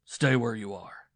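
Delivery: rough, slow, forceful command; husband roughly stopping his wife. A narrator's reading. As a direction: bark it out as a threat. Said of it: "he said roughly".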